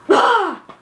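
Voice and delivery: sharp, loud, high huff of exasperation; big exasperated exhale; exasperated sigh. Real, not acted. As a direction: huff it out hard. Venting after mock rant.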